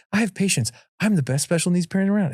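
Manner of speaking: proudly talking yourself up, tongue in cheek; self-assured parent. Acted. Dad hyping himself up in tough moments, mock self-assured.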